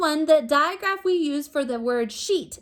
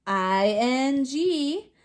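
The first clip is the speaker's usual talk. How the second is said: slow letter spelling; much slower than usual. Shown as a demonstration. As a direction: spell out I N G very slowly. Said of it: "The suffix is".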